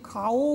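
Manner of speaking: খাও stretched to kha-OO, o fully sounded; over-full vowel. Shown as a demonstration. Teacher showing what we don't say, full vowel. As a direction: say খাও as two syllables, kha and long o. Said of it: "বলি না".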